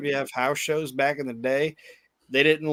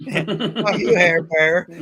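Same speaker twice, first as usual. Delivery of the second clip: higher, mock jeering taunt; heckling kids; 4 semitones higher, 6 dB louder, much slower than usual. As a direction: imitate a kid heckling from the crowd. Joining in the kids' mocked heckle.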